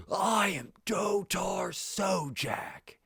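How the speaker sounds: high, slow, proud proclamation; heroic declaration. Retold or imitated. Imitating John Carter proudly declaring his Mars name. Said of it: "he says it with such confidence and such bravery".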